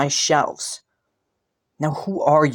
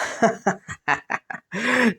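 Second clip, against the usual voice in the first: amused laugh; 7 semitones higher than usual. Acted. Amused prince laughs at claim.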